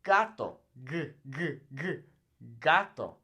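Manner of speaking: gato with a hard G. Shown as a demonstration. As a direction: land the G in gato firmly. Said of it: "two sounds once again".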